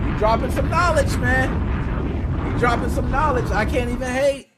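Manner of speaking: loud, hyped shout-out; big up the caller loudly; hyped praise. Real, not acted. Host hyped by the caller's point, loud praise.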